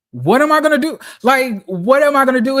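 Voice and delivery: loud, desperate what am I gonna do; panicked shout. Real, not acted. Laid-off worker yelling at the news in panic. Panicking over sudden layoff.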